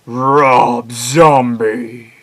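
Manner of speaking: loud, hugely stretched proclamation; booming drawn-out horror-show shout; monster announcer. Acted. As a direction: belt the name out very slowly, hammed-up. Announces singer's name in performed voice.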